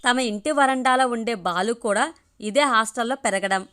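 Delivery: plain reading voice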